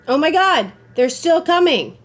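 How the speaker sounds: high, loud alarmed cry; loud, high frightened yell; panicked shout. Real, not acted. Enemies still chasing.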